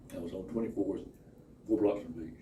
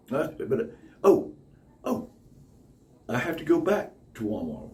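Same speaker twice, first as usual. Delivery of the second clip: ordinary talk, nothing marked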